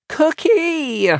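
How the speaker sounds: put-on very high child's shriek. A narrator's reading. Maisie summoning big sister to step in, shrieking. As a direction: shriek for help at the top of the range. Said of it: "Maisie shrieks".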